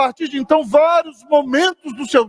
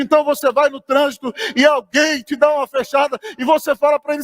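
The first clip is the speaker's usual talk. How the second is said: normal talking voice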